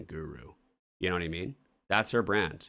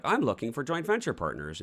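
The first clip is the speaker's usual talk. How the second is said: higher-pitched mock networker pitch; eager networker; 7 semitones higher, 3 dB louder than usual. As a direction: play an over-keen event-goer introducing themselves. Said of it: "go in there and be like".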